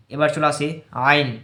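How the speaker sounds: ordinary delivery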